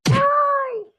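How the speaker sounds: sharp high yelp of pain. Acted. Henchman yelping when struck. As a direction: cry out as the blow lands. Khamsin hit.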